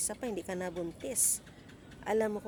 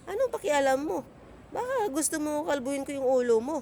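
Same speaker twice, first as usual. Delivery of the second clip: sharp, loud, higher comeback; angry retort; 6 semitones higher, 6 dB louder than usual. Retold or imitated. Talking back to the coworker who gossiped, loud and angry.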